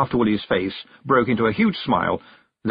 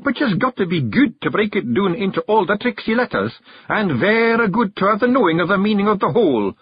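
boastful Scots chief; Rob Anybody; 11 semitones higher, 3 dB louder than usual. Acted. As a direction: lord it over the clan. Rob lectures.

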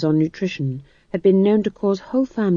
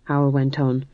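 ordinary delivery, nothing marked

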